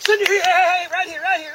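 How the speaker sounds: loud call to the dog. Real, not acted. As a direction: call her in from afar. Calling dog back loudly.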